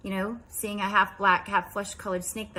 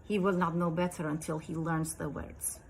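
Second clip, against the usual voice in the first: imitated Russian mother, a little lower; Russian accent; 3 semitones lower, 5 dB quieter than usual. Russian mother answering about her little boy, Russian accent. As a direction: lecture in a put-on Russian accent. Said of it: "this is my best Russian accent".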